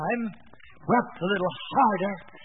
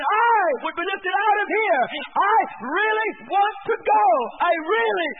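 loud, high preaching cry of longing; shouting; 7 semitones higher, 4 dB louder than usual. Real, not acted. Preacher to congregation, longing for heaven, shouted high. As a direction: cry it out from the pulpit, raised and pleading.

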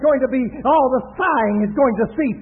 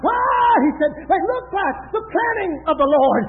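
raised, shouted sermon delivery; full-force preaching; 3 semitones higher, 6 dB louder than usual. Acted. Preacher pictures Israel's tents for the congregation, full-force preaching. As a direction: preach it out with long, cried-out openings.

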